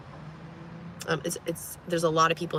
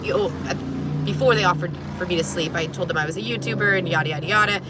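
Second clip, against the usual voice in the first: usual speaking voice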